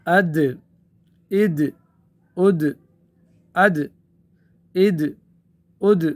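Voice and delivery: اد with the final د bounced and echoed; qalqalah on ad. Shown as a demonstration. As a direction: release the sakin د of اد with a springy echo. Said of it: "اس میں قلقلہ ہوگا". (اد میں دال کا قلقلہ، جھٹکے سے)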